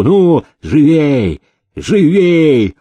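insistent master's order; impatient urging. A narrator's reading. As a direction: hurry the servants along, drawing the word out. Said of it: "заторопил их хозяин".

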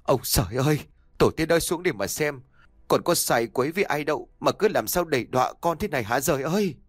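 rising, rushed cry to heaven; anguished lament. A narrator's reading. Father cries out to heaven, rising and rushed. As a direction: wail to the heavens in despair. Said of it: "khóc nấc lên từng tiếng nghẹn ngào".

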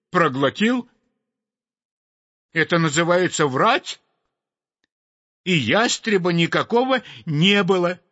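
sparrow pouring out his grievance; indignant sparrow. A narrator's reading. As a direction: sparrow complaining to the sweep, outraged and high. Sparrow's long angry complaint.

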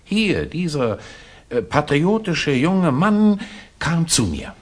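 fawning eagerness, showing off his find; an official proudly presenting the young informer to His Excellency; eager presentation. Acted.

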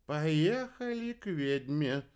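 dreamy slow astrologer. A narrator's reading. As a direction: propose it high and drawn out. Astrologer's character voice.

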